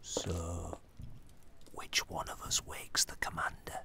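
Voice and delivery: soft nervous whisper; timid whisper. Acted. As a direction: whisper so she won't wake. Hushed at night.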